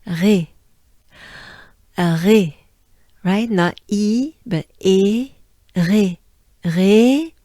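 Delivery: Ré with a throaty French R, said firmly. Shown as a demonstration. Teacher drilling the French R in Ré, firm and clear. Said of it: "it's sort of the French R".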